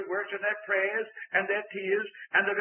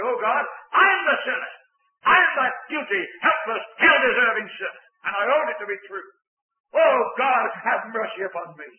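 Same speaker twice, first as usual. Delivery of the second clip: louder, fervent cry to God; earnest confession; 3 semitones higher, 7 dB louder than usual. Shown as a demonstration. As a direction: pour it out, owning every word. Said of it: "haul yourself into the light of God's presence and say".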